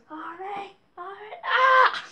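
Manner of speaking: high mimicked cry ending in a yell; imitating game character. Player shows what a favorite character goes, high mimicking cry. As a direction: mimic his high call, bursting into a shout. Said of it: "'Cause he goes".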